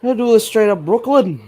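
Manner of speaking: loud, high-pitched Brooklyn voice; Brooklyn accent. Acted. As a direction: belt it out in an exaggerated Brooklyn accent. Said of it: "It's just the Brooklyn voice".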